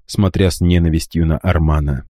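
ordinary delivery

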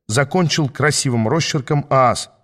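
usual speaking voice

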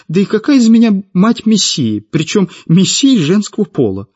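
high-pitched, aggrieved protest; plaintive. A narrator's reading. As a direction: keep complaining, pitch thrown up high. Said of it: "жалобно спросила Светлана".

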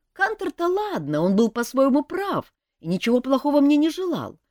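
put-on young elf prince. A narrator's reading. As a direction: confess it seriously but eagerly. Принц изливает досаду. (молодой принц-эльф, серьёзно, выше и громче)

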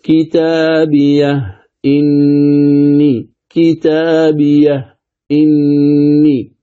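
كتابية إني recited slowly, silent ha then a clear hamza on إني; slow Quranic recitation with a full glottal stop before إني; تلاوة بتحقيق الهمز; tahqiq reading. Shown as a demonstration. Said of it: "الوجه الثاني التحقيق،".

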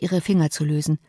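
ordinary delivery

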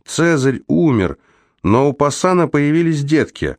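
high-pitched, carrying delivery without real shouting; calling over engine. A narrator's reading. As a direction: call the news out high, as if over a motor. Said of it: "закричал Раймонд громко и резко, чтобы заглушить грохот двигателя". (повышенным тоном, будто перекрикивая мотор)

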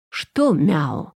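usual speaking voice

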